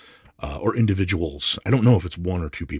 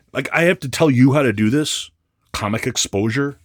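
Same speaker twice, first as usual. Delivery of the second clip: exasperated rant; 7 semitones higher, 8 dB louder than usual. Real, not acted. Ranting at the seller.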